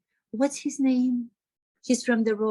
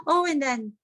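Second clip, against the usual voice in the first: usual speaking voice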